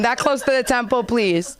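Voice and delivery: ordinary talk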